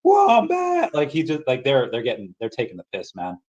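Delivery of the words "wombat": high screeching mock scream; Nicolas Cage scream. Tortured Big Daddy in Kick-Ass Two, hammed-up scream. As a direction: shriek it like a tortured man. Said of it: "he's screaming".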